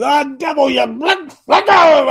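high, loud charismatic rebuke rising to a shout; rebuking the devil. Retold or imitated. Stuck driver rebuking the devil, hammed-up charismatic shout. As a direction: command the devil to let go. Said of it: "I'm rebuking the devil".

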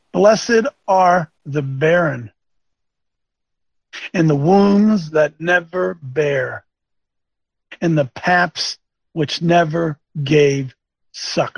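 slow, grave preacher's reading; measured pulpit reading, slowed right down; solemn scripture reading. Acted. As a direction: lay each phrase down slowly.

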